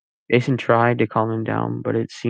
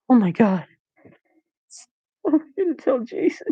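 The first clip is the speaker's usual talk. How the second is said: much higher, panicked voice; shocked gasp; 13 semitones higher than usual. A narrator's reading. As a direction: realise it in horror. Max in shock.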